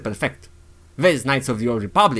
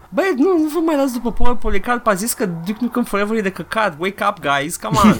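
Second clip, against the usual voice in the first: exasperated protest; 7 semitones higher than usual. Real, not acted. Protesting at following a critic.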